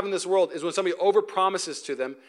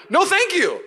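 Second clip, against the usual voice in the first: mock refusal; 11 semitones higher, 6 dB louder, slower than usual. Refusing the reckless pilot.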